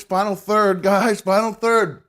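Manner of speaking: high, loud hype; rally the chat; excited announcement. Real, not acted. Announcing progress.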